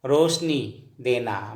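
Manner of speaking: slowly and deliberately, word by word; slow teacher's dictation. Shown as a demonstration. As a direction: spell it out for the students at a slow pace.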